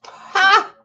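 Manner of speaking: sharp high mocking "Ha!"; a single high burst of scorn; scoffing laugh. Real, not acted. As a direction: derisive bark of a laugh. Scoffing at news.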